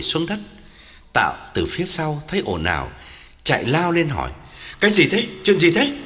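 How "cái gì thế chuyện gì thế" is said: raised, higher-pitched urgent questions; loud, startled husband; alarmed questioning. Acted. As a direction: rush in demanding to know. Husband rushes up at the commotion.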